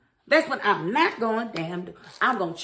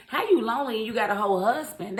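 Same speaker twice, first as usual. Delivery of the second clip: ordinary talk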